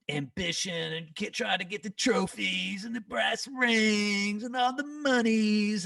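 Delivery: high, drawn-out mock dreaminess; mocking grandiosity. Bandmate sends up chasing trophies and money, high mocking drawl. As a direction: stretch the words out in a high, silly croon. Belittling the chase for success.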